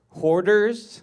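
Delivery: mock proud, loud reply; loud retold answer. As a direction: blurt it out loud for the punchline. Retold answer, blurted loudly.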